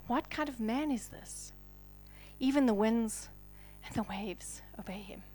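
soft, hushed whisper; awed whisper. Acted. Disciples asking about Jesus, an awed whisper. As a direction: whisper it in awe. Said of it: "and asked".

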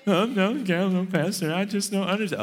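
high whiny stammer; mock whiny excuse to the pastor; whining churchgoer. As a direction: whine and stall like a reluctant member. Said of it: "when you whining".

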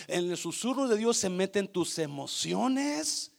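ordinary talk, nothing marked

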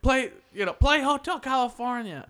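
high, loud mock heckler's demand; imitated drunken yelling. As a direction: yell a song request like a tipsy heckler. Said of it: "she'd be yelling at Zach".

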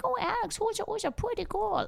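put-on high, soft cooing baby talk. Shown as a demonstration. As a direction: gush softly as if to a pet. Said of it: "I want your baby voice".

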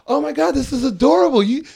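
high, loud amused incredulity; hammed-up astonished ridicule; mock disbelief. Retells laughing disbelief at the threat.

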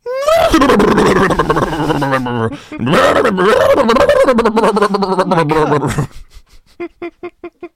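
long loud revving engine noise; motorbike engine roar. Pretend. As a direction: rev it up like a Harley. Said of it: "You sound like what's his name?".

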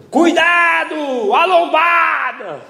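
high, long-drawn mock shout; shouted warning. Passenger warning the driver of a speed bump, retold shout. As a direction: stretch each word out in a high yell. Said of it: "quando o cara gritou".

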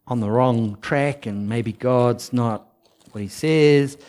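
normal talking voice

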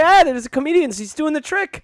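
very high mock excitement; delighted audience. Audience member spotting the comedian's trick, delighted. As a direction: marvel like an easily thrilled spectator.

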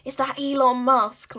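imitating a nan whispering far too loudly; failed whisper. Nan giving away quiz answers to family, failed whisper. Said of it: "trying to whisper, like, air quotes".

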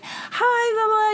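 high, airy put-on greeting; lightly mocking, way up high. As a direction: imitate a guest's high-pitched hello. Imitating the guest's greeting.

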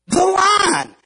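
high, joyful cry; relieved ant. Acted. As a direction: greet the line with relief. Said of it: "completely freaks out".

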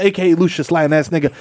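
ordinary delivery

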